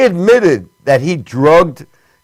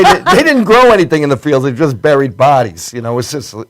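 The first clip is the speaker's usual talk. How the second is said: normal talking voice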